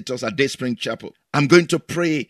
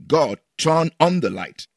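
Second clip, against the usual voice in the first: usual speaking voice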